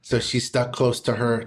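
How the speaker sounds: usual speaking voice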